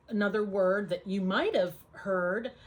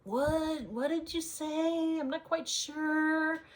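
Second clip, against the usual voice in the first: high, slow puzzled asking; baffled; 8 semitones higher, slower than usual. Retold or imitated. To a local with a strong accent, high baffled asking. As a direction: ask back as if you caught none of it. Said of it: "and you're like".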